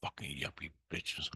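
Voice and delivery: whispered cursing under the breath; bitter whisper. Real, not acted. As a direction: whisper it to yourself with contempt. Muttered venting after long pause.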